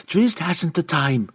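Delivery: high, tense, slowed retort; growled. A narrator's reading. Young woman refusing delay at the locked door, growled. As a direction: bite back in angry urgency. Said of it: "Canterbury growled".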